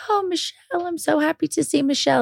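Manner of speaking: high, sing-song mock of her own clueless cheer; hammed-up cheerful hello, pitched high; oblivious cheery greeting. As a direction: play your past self sweetly clueless. Mocking her oblivious cheerful self.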